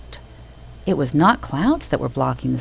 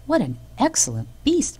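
strange little man's cry; 9 semitones higher than usual. A narrator's reading. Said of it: "cried the man".

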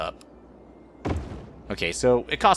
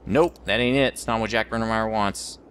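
ordinary talk

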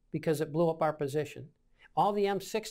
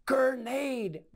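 high, drawn-out warning yell; retold yell; 7 semitones higher than usual. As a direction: call a warning across the position. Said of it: "And I yell".

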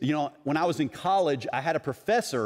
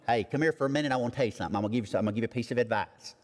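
put-on Southern accent; 4 semitones lower, much faster than usual. Somebody offering a piece of advice, in a mock Southern accent. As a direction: imitate a folksy Southern accent, getting quicker. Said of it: "when people have a real Southern accent".

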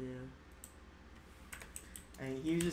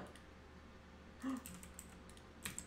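quick startled gasp; 8 semitones higher, 11 dB quieter than usual. Real, not acted. Spotting enemy in base.

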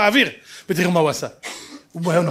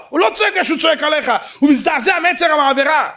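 much higher and louder, pressing hard; rising sermon shout; 8 semitones higher, 5 dB louder than usual. Real, not acted. Preacher roaring at his flock about sin. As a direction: thunder the warning at full force.

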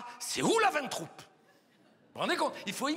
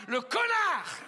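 high, indignant shout; indignant insult; 7 semitones higher, 4 dB louder, slower than usual. Acted. Comedian curses the model in perfume adverts, indignant. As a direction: spit the insult in comic outrage.

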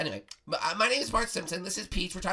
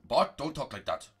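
wise master voice; 14 semitones lower, 6 dB quieter than usual. Acted. Master Prego tells a tale.

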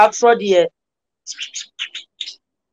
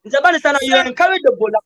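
usual speaking voice

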